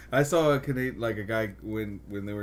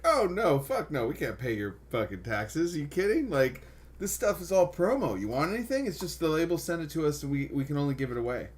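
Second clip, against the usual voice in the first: mock indignant; 6 semitones higher, 4 dB louder than usual. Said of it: "And I was like".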